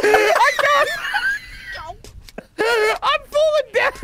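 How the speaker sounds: high, squeezed words through laughing; helpless laughter. Real, not acted. Player falling as he laughs at his friend, helpless laughter. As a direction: barely get it out while cracking up.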